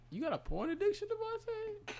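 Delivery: high shocked mom voice; mother catching her son out; imitating mom. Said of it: "My mom's gonna be like".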